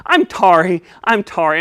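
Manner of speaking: "tarry" for sorry, S turned to T; lisping puppet. Pretend. Puppet apologising, "tarry" said with T for S. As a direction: say "tarry" with a T replacing the S.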